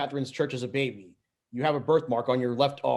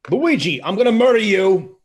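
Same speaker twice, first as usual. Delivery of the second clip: loud, much higher furious shout at Luigi; furious yell; 8 semitones higher, 10 dB louder than usual. Acted. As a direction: scream it after him as the car chases. Mario yells at fleeing Luigi.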